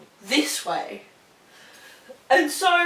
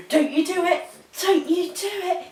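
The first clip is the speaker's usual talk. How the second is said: higher, firm warning to the dog; 8 semitones higher than usual. Real, not acted. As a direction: tell the puppy off before she does it.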